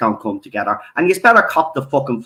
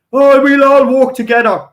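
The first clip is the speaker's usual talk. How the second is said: high, louder sarcastic singsong; mock pretending; 11 semitones higher, 6 dB louder than usual. Sneering at YouTubers faking unity, high and loud. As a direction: parody their fake unity. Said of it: "yous all pretending".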